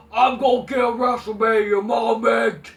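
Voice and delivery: loud mocking yell of the line; mock shouted boast. Fan imitating Almas, loud mock shouted boast. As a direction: bellow it like a cocky wrestler. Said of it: "same thing with Almas".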